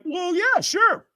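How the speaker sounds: high-pitched, unconvincing acceptance; feigned casual agreement. Retold or imitated. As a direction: go squeaky while pretending it's no problem.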